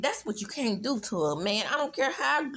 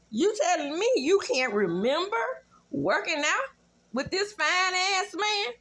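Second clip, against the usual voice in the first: mock disbelief; 6 semitones higher than usual. Commentator mocking the wife's claim, incredulous. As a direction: act stunned she forgot him.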